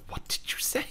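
whispered mock menace; soft, voiceless retold threat; hushed challenge. Acting Stan challenging Mabel's insult.

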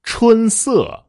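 春 high and level, 色 falling from that height; joined tones. Shown as a demonstration. Teacher giving an example, 春 high and 色 falling steeply. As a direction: hold 春 high, drop 色 from the top. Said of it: "比如说".

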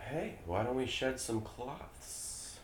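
a quiet, slimy predator; creepy predator voice. Acted. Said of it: "Sam, why don't you be Dan".

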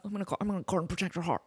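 fast, odd put-on voice; mock weird-voice guy. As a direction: parody the earnest Bachelorette guy. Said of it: "the guy with the weird voice".

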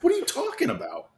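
high-pitched incredulous retort; baffled players. Retold or imitated. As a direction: imitate a whole team's disbelief. Acting out baffled players' reply again.

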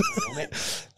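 a sudden high-pitched laugh; laughing at the absurd weight; high squeal of laughter. Real, not acted. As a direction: squeak with disbelief. Laughing at world record.

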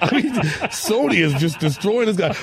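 high, laughing delivery; laughing while talking. Real, not acted. Laughing as he tells Dan about the fight. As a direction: talk through real laughter, pitched high.

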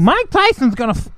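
lightly done high boxer voice; Mike Tyson. Acted. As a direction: attempt the famous boxer's voice. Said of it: "I'm done with the Mike Tyson".

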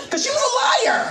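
high, loud laughing cry; laughing outburst. Real, not acted. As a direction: break into laughter on the punchline.

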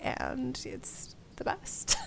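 slow breathy whisper; mock whisper. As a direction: whisper it smugly. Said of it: "it's gonna be like".

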